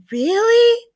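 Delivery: thrilled disbelief at good news; eager child's squeaked question; excited. A narrator's reading. Child hears of extra presents.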